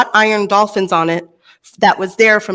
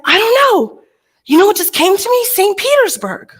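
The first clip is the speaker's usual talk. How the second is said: much higher, airy mystic revelation; inspired psychic; 11 semitones higher than usual. Retold or imitated. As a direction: voice the psychic as the answer hits him. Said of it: "He's like".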